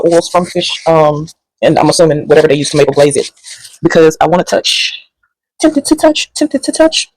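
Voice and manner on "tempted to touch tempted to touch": high teasing tone. Acted. Resisting touching the food.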